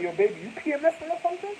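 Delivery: put-on high, fast teasing boyfriend; mock boyfriend. Imitating the boyfriend teasing his girlfriend.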